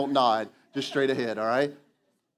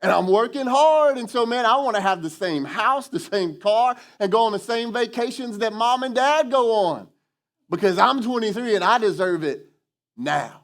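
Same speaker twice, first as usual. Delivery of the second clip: higher, louder mock whiny young adult; entitled twenty-something; 5 semitones higher, 3 dB louder than usual. Young adult demanding parents' lifestyle, higher whiny mock voice. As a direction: play a spoiled twenty-three-year-old demanding it all. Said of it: "where they go".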